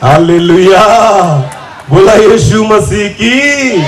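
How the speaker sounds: slow, drawn-out hallelujah; slow worship call. Acted. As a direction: draw out the praise call slowly. (सावकाश hallelujah घोष)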